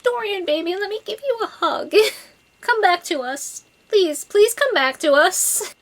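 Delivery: high, tender coo with a laugh; cooing to Dorian. Retold or imitated. Fan begging her favorite character to come back, high tender coo. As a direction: coax him back like soothing a hurt friend. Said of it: "I'm just like".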